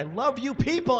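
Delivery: high-pitched burst of adoration; gushing to the crowd. Real, not acted. Performer to the audience, gushing.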